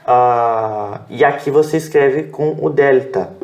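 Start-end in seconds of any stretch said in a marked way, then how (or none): none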